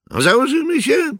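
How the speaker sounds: quick, very high youth's voice; high boyish voice. A narrator's reading. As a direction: pitch it up like a cocky boy. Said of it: "Miał wysoki chłopięcy głos". (wysoki chłopięcy głos)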